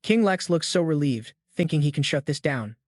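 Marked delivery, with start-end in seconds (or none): none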